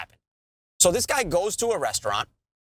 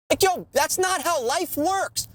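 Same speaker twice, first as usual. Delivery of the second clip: higher, slow exasperated protest; indignant disbelief; 9 semitones higher than usual. Real, not acted. Ranting to a friend about the lawsuit, slow and exasperated. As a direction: spell it out like it's obvious.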